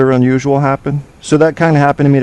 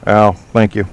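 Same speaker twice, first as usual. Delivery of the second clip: usual speaking voice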